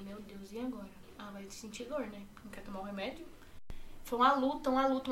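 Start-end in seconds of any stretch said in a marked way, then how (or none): 0.0-3.2 much softer, anxious under the breath; hushed worried wondering; worried murmur. Retold or imitated. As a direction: fret quietly to yourself. Retold worry, much softer.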